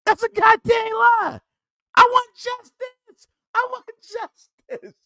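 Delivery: high, outraged cry for justice, slow on the last words; outraged shout. Real, not acted. Calling out a liar to the chat, outraged high shout. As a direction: shout it like you've been wronged.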